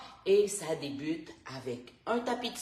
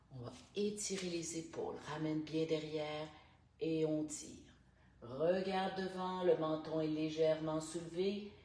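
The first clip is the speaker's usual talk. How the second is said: calm, unhurried instructor guiding each breath; guided stretching voice; 7 dB quieter than usual. Acted. Morning stretch coach guiding listeners through neck and shoulders, calm. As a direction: talk the class softly through every stretch.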